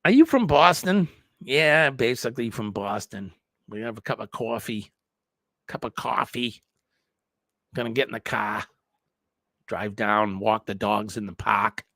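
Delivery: put-on Boston local, chatting casually; Boston accent. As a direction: play up a New England accent, "cawfee" and dropped r's. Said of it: "like we say it here in New England".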